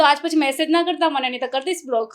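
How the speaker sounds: a playful but firm don't-text-me-again; retold threat. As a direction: threaten to block him, half in jest. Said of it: "ધમકી આપે છે".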